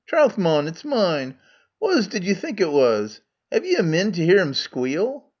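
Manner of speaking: Scots Megarian. Acted. Megarian role in Scots dialect.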